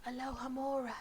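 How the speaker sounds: very soft, secretive incantation; hushed magic word; whispering. A narrator's reading. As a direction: whisper the spell. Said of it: "tapped the lock and whispered".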